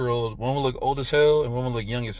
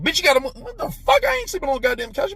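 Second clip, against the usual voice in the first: much higher, louder, fast indignant rant; hammed-up furious refusal to sleep on the couch; mock outrage; 12 semitones higher, 3 dB louder than usual. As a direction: squeal it out like you're outraged.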